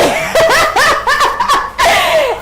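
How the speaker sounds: long, high-pitched giggling laugh; high delighted laugh. Real, not acted. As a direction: laugh high and freely after a cheer. Laughing after her cheer.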